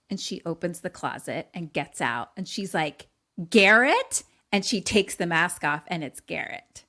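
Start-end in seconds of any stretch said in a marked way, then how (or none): 3.5-4.2 sharp, louder rising question; shocked recognition. Retold or imitated. Girl unmasks the intruder in the closet, shocked. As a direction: blurt it out in disbelief.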